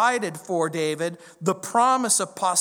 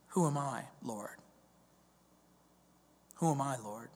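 humble whisper; 5 semitones lower, 10 dB quieter than usual. Acted. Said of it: "David says".

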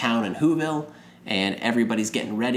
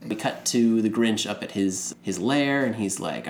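ordinary talk

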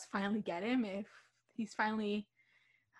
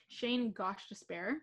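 ordinary talk, nothing marked

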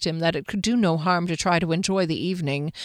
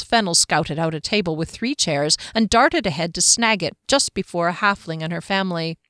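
plain reading voice

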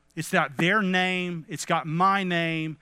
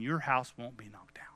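hushed reassurance; 7 semitones lower, 9 dB quieter than usual. Acted.

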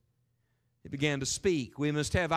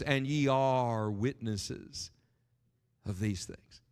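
solemn scripture reading; 5 semitones lower, much slower than usual. Acted. Quoting Jesus solemnly.

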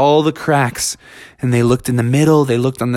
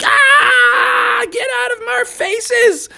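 very high scared scream; panicked shriek; much slower than usual. Acted. Friends shriek as bats fly in their faces, very high.

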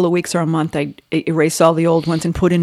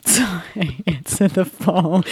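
ordinary talk, nothing marked

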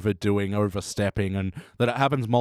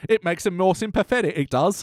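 higher-pitched mock Cockney; Cockney accent; 7 semitones higher than usual. Co-host sending up the sympathetic act, higher Cockney accent. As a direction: put on a pleading, sympathetic Cockney. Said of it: "his most sympathetic Cockney accent".

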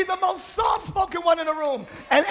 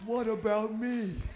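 quiet mock voice of pride; 7 semitones lower, 10 dB quieter, slower than usual. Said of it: "pride screaming".

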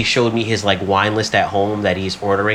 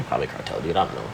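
low mumbled aside; 9 semitones lower, 9 dB quieter, faster than usual. Real, not acted. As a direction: lower and much softer. Muttered aside about cartel.

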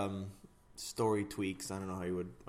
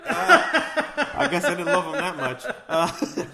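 laughing; 7 semitones higher, 11 dB louder, slower than usual. Real, not acted. Laughs at forgetting names.

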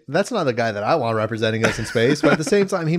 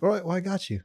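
higher, softer fake British accent; 6 semitones higher, 6 dB quieter than usual. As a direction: put on a soft, raised British accent. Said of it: "You're just using a fake accent".

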